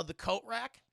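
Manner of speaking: normal talking voice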